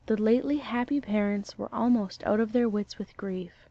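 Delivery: ordinary delivery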